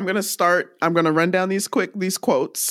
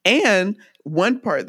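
ordinary talk

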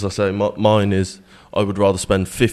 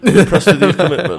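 repeated line, higher and louder with a laugh; 8 semitones higher, 10 dB louder, faster than usual. Real, not acted. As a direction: crack up while saying it.

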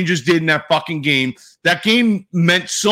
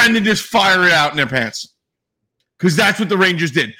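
usual speaking voice

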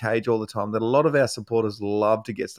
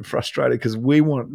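ordinary talk, nothing marked

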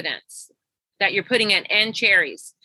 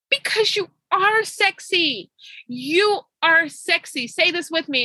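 emphatic affirmation; 6 semitones higher than usual. Retold or imitated. Coach telling her client to believe it, emphatically affirming. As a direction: drive each word home as encouragement.

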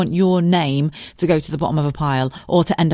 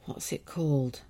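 hushed, near-whispered aside; barely voiced self-talk; muttering to herself; 13 dB quieter than usual. Real, not acted. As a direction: murmur it absently while looking something up. Muttering while searching her phone.

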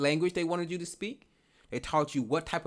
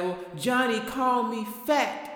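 put-on child voice; 7 semitones higher than usual. Child voiced crying to parent.

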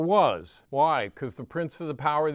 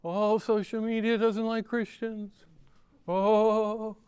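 whiny high mock worrier, pitched up; 7 semitones higher than usual. Preacher mocking anxious Christians, high whiny mock voice. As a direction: lampoon the hand-wringing believer.

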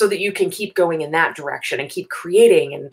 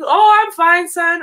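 put-on high, loud, cheerful old man voice; cheerful old man who mishears his son; deaf old grandpa; 10 semitones higher, 6 dB louder, slower than usual.

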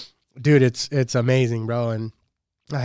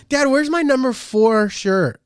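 put-on high, slow, louder little-girl question; imitating his daughter; 11 semitones higher, 5 dB louder, slower than usual. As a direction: ask it slowly and eagerly like a small kid. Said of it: "She'll put it on".

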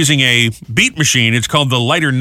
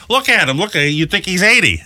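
imitating Gail; 5 semitones higher than usual. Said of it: "I was thinking that Gail there".

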